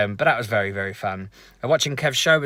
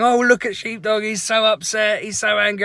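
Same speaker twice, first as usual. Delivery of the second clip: much higher, louder mock-sympathetic tease; teasing Anna; 12 semitones higher, 7 dB louder than usual. Anna teasing Sheepdog about his dead phone, high mocking coo. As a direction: coo over him like a sulking child. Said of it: "And Anna's going".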